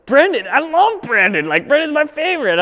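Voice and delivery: high, loud gushing; excited shop owner. Retold or imitated. Hippie Mike thrilled that Brendan is coming, high excited gushing. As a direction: gush over an old favorite.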